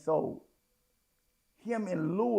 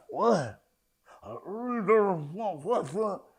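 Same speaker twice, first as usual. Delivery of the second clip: mocking defensive tone; slower than usual.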